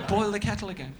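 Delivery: high mock outrage; angry barista. Imagined coffee shop worker scolding a customer, high mock outrage. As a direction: bark at the customer like a cabbie would.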